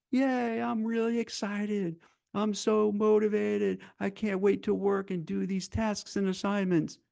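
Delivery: high, slow, sugary fake excitement; mock the eager employee with a bright sing-song; mock enthusiasm. Career coach mocking how bosses expect workers to sound, high fake cheer. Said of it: "how does the C-suite expect people to come in".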